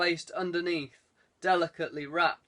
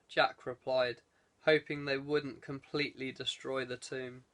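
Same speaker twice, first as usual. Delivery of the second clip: usual speaking voice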